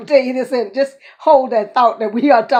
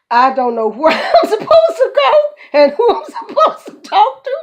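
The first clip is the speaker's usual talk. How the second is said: high laughter that tips toward crying; frustrated laughter close to tears; laughing hard; 9 semitones higher, 6 dB louder than usual. Real, not acted. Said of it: "I was laughing so hard".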